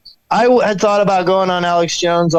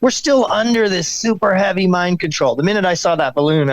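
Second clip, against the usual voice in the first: usual speaking voice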